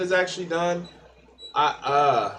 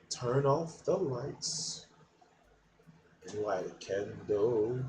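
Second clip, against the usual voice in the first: slow and much softer; hushed; 6 semitones lower, 11 dB quieter, slower than usual. Acted. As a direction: drop to a hush before the punchline. Crude joke, hushed before the punchline.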